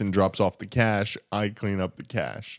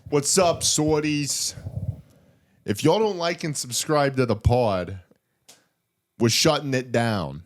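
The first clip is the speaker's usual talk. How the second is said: put-on Brooklyn accent; 4 semitones higher, slower than usual. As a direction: talk like a Brooklyn tough guy. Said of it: "in a thick Brooklyn accent".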